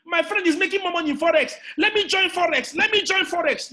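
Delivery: high-pitched, excited mimicry; mocking eager follower. Speaker mocking people rushing into Forex, high and eager.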